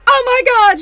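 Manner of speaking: high, loud shriek; imitated ecstatic shriek; shriek of excitement. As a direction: shriek with joy like Charlotte. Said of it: "Charlotte stands up and then she's like".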